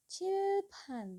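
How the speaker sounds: Cilpan with air bursting out on c and p, pitched high; aspirated Korean. Shown as a demonstration. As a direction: push air on both stops of Cilpan. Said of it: "harus ada udara yang keluar". (Cilpan dengan udara keluar, nada tinggi)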